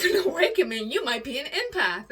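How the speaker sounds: high, loud echo of astonishment; incredulous reaction. Retold or imitated. Retelling her reaction to "you might be an empath", incredulous. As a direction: exclaim it in rising disbelief.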